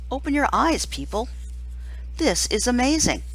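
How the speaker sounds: usual speaking voice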